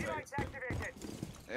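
mock-preach "why" long and high; drawn-out mock emphasis. Shown as a demonstration. Repeats line, second drawn out.

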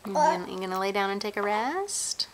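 normal talking voice

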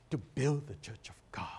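breathy whisper of the same line; hushed, reverent repetition; whispered in awe. Acted. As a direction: whisper it as if moved. Repeats the reply in awe.

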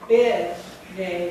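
teacher sounding out a word for the class, slow; slow syllable sounding. Shown as a demonstration. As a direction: stretch out Combination, leaning on nay. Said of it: "We're gonna write".

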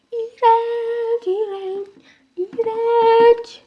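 high, drawn-out gross-out; disgusted. Real, not acted. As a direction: squeal your disgust. Reacting to eating snail.

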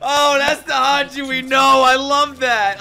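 loud, high, thrilled shouting; a fan's overjoyed yell; excited cheer. Real, not acted. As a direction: cheer like your favourite just came back. Thrilled Hanji is back.

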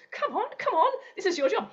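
high-pitched, hurried manager urging; frantic urging. Acted. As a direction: urge someone on from across the room. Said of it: "signalling more and more frantically".

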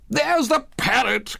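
high-pitched cry of delight; excited cry. A narrator's reading. Scrooge spots the storybook parrot, excited cry. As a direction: cry out at a thrilling sight. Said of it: "cried Scrooge".

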